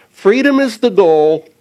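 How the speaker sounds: usual speaking voice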